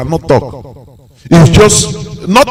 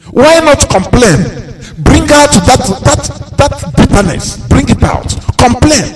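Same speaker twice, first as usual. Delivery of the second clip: much higher, pressing call to complain; urgent plea; 8 semitones higher than usual. Real, not acted. Speaker urges Nigerians to complain, higher and insistent. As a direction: rouse them to speak up. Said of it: "urging listeners to complain".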